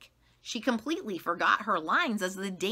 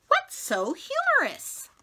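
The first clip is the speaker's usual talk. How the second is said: much higher, louder, sing-song question; a bright high teacher asking; 7 dB louder than usual. Acted. Missus Twinkle asking the giggling students, high and loud. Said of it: "asked Missus Twinkle".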